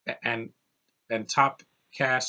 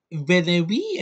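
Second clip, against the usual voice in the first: higher, louder put-on French accent; mock French accent; 6 semitones higher, 5 dB louder, slower than usual. Reviewer mimicking the actress's movie role in a French accent. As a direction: toss it off like a mock Parisian starlet. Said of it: "they give her an accent from France".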